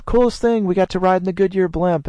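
high, excited gush; a visitor bursting to share the coolest ride; gushing tourist. Retold or imitated. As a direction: rave about it like a thrilled visitor. Couple raving about blimp ride.

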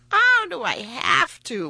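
loud, slow, high whine; whining. A narrator's reading. King balks at paying the farmer, whining. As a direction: drag it out like a reluctant child. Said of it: "whined the king".

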